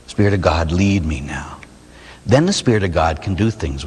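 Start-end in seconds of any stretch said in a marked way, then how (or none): 0.1-1.6 low prayer sinking to a whisper. Shown as a demonstration. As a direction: breathe the plea almost unvoiced. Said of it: "I surrender to God's Holy Spirit".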